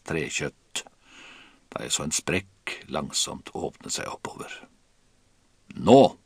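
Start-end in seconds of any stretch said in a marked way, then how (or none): 5.8-6.2 one loud, high command; Kaptein Wilkinson shouting. A narrator's reading. Captain signals as the mast cracks, shouted. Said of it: "skrek kaptein Wilkinson".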